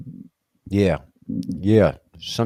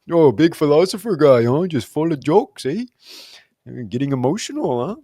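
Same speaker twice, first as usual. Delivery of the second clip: heckler voice; 5 semitones higher, 4 dB louder than usual. Retold or imitated. Mocking himself as heckler.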